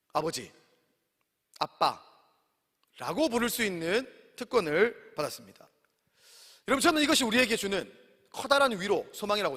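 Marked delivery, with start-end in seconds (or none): 0.1-5.7 soft, slow, hushed word for father; tender hush. Acted. Preacher to congregation, tender hushed slow. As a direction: breathe it out gently.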